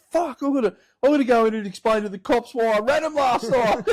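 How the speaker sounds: high, loud rant, breaking into laughter; losing it. Retold or imitated. Old boy dreading explaining to cops, losing it. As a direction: rant as an exasperated dad, half laughing. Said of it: "He's, like, lost it".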